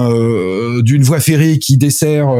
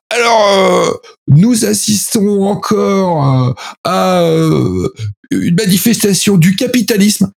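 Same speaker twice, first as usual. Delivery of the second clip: high, very slow, pompous politician voice; mock socialist orator; 6 semitones higher, slower than usual. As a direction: parody a solemn party speech. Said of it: "C'est dit comme ça".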